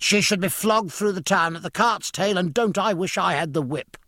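high-pitched indignant woman; high, vindictive outburst from a gossip; outraged gossip. A narrator's reading. Outraged aunt wants a flogging.

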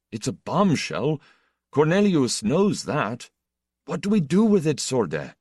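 high, pressing, agitated questions; a flustered official who can't sit still; urgent and flustered. Acted. Flustered deputy pressing for answers.

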